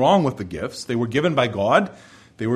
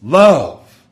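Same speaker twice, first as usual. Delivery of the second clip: dramatic reveal; 9 dB louder than usual. Acted. Paul reveals the greatest gift.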